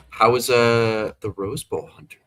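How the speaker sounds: ordinary delivery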